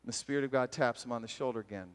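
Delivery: ordinary talk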